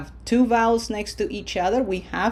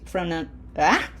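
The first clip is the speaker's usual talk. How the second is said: usual speaking voice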